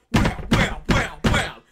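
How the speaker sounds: punched-out, very loud whams; loud impact noises. Acted. Game master acting out fish hitting the wall, loud. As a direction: slam each word like a heavy blow.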